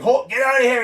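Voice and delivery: hammed-up yelled command; grandpa. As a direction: imitate an angry grandpa throwing someone out. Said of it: "the grandpa's like".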